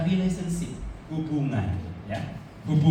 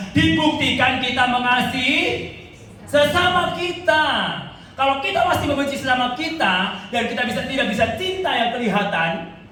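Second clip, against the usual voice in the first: louder, driving sermon peak; preaching at full force; 3 semitones higher, 4 dB louder than usual. Acted. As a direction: press the point home to the congregation. Preaching climax. (berkhotbah dengan penuh semangat)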